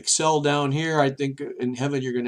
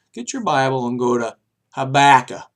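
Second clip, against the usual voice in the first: loud urging; 8 dB louder than usual. Real, not acted. Loud urging to read scripture.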